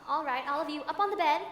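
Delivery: put-on rally the kids onto the bed; calling out. Governess gathering scared children in a storm, calling out.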